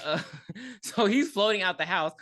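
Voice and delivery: usual speaking voice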